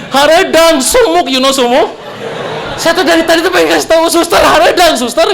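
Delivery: a hammed-up cry that it's too hot; mock pleading. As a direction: beg the nurse, half laughing. Wanting to tell nurse it's hot.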